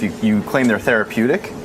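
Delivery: usual speaking voice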